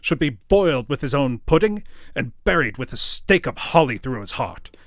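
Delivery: loud, building to a shouted end; indignant fury. Acted. Scrooge cursing merrymakers, building furious shout. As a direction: rage until the last word explodes. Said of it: "said Scrooge indignantly".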